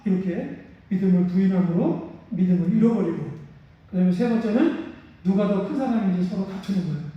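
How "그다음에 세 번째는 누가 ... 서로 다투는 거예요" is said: ordinary talk, nothing marked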